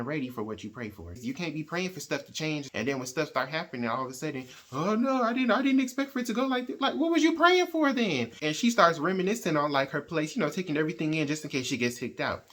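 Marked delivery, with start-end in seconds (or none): 4.7-6.8 much higher, louder whiny fake alarm; high, loud parody of a frightened hypocrite; mock panic. Mocking scared hypocrites. 6.8-8.3 much higher, louder incredulous jab; loud incredulous rebuke; exasperated. Real, not acted. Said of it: "speaker exasperated at hypocrites".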